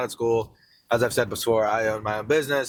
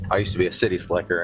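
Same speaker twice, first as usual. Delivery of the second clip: ordinary talk, nothing marked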